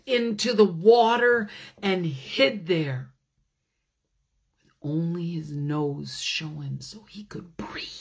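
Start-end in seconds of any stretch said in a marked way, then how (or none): 4.8-7.9 hushed whisper for hiding; storyteller whisper. A narrator's reading. To children about the hiding bear, whispering. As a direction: whisper so the bees won't hear.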